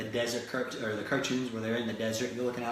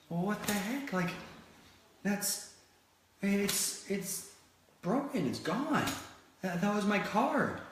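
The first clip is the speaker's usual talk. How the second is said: dismayed crash victim; 8 semitones higher, slower than usual. Retold or imitated. Crashed driver looks at wreck.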